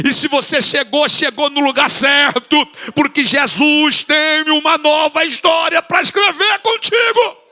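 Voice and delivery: loud, rising preacher's cry over music; full-force altar-call preaching. Acted. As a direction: build it up to a shouted climax.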